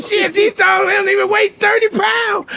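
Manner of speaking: high laughing disbelief; mock shocked outrage. At the pet store he sees the price tag, high shocked laughing outrage.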